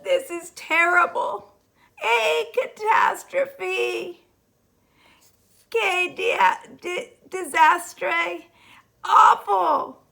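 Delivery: high, crying wail of disaster; wailing despair. A narrator's reading. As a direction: whimper over the catastrophe. Eraser despairing wails.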